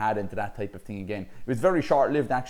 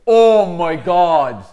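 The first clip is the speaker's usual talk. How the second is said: 1 shocked; 6 semitones higher, 14 dB louder, much slower than usual. Retold or imitated. Seeing his broken nose reflected.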